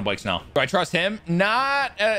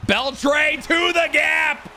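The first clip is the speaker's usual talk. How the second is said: play-by-play call; 13 semitones higher, 4 dB louder, much slower than usual. Real, not acted. Calling a hit like commentator.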